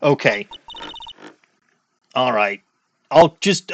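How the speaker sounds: ordinary talk, nothing marked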